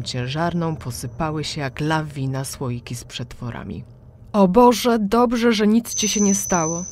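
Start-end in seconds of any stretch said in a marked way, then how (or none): 4.3-6.8 high, loud panicked gasp of relief; frightened cry. A narrator's reading. Trapped mother cries out in fear, high and loud. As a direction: cry out in fear under the shelf. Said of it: "krzyknęła przerażonym głosem".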